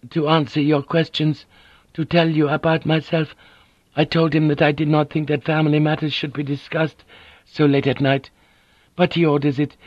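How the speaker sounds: a poised noblewoman smoothing things over; polite Japanese lady. A narrator's reading. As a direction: voice Mariko as a courteous, composed wife. Narrator voices Mariko.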